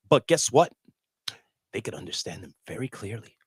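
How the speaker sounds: ordinary talk, nothing marked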